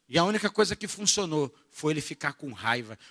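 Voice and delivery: ordinary talk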